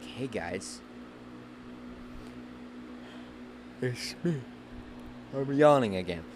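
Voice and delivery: quiet, bored and sleepy; Michael Jordan bored. Retold or imitated. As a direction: mumble it like you're half asleep. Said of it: "be like".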